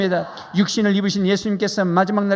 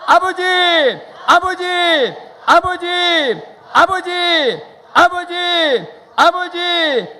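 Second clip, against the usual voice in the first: high, loud calling out to the Father; three full-voiced calls of 아버지; loud cry; 10 semitones higher, 5 dB louder, much slower than usual. Real, not acted. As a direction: cry out to God as loud as you can. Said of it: "다시 일곱 번 불러보겠습니다".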